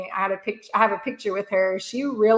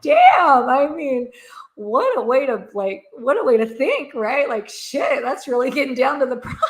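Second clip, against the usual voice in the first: impressed exclamation; 5 semitones higher, 4 dB louder than usual. Retold or imitated. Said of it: "I'm like".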